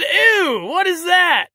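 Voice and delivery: high, prissy mock disgust. Fan imitating Roman sneering at the Packers belt, high and disgusted. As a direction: ham up a squeamish ew. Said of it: "He said".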